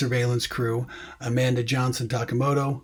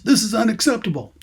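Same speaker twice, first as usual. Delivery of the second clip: higher, louder mock yell; acted yell; 10 semitones higher, 5 dB louder than usual. Reading an angry character's line from the script, acted yell. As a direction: read it as an outraged yell. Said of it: "So she yells".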